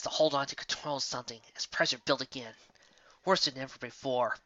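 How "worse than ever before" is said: usual speaking voice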